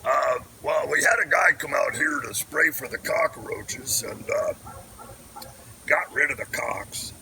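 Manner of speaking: a lightly voiced other guy; different voice. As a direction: slip into a mild character voice. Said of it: "I really should do different voices".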